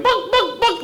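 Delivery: high mocking chicken cluck. Middle-aged men taunting him, high mocking chicken clucks. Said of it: "actually going".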